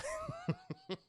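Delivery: soft amused chuckle. Real, not acted. Streamer amused by his own quote, soft chuckle. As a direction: chuckle quietly to yourself.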